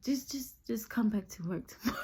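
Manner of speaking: soft, halting acted manager; hushed dismissal. Manager sending her home till tomorrow, acted hushed voice. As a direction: say it softly, stumbling at the start.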